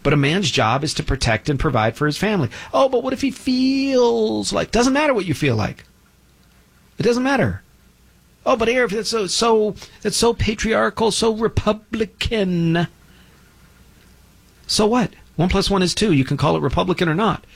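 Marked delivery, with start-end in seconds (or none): 2.7-4.7 high, drawn-out mock protest; mock objection. Host mimicking a listener's objection about feelings, mocking. 8.5-12.9 high, slow, drawn-out mock complaint; mocking critic. Host mimicking critics who object to his views, mocking.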